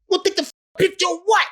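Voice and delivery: light impression of a high-pitched tough guy; imitating Mike. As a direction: squeak it out like a high-voiced boxer. Mike demands a picture, voiced.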